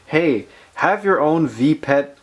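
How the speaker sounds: ordinary talk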